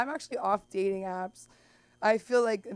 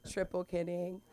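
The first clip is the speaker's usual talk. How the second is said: ordinary talk, nothing marked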